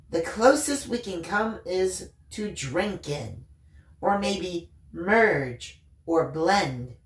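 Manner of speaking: slow, drawn-out words, savouring each one; unhurried musing; dreamy and slow. Acted. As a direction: let the words drift out slowly, as if tasting them. Michaela dreamily describes grokking.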